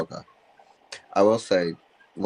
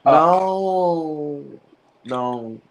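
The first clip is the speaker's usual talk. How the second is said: dismayed no; 8 semitones higher, 5 dB louder than usual. Real, not acted. Dreading a beloved star's death.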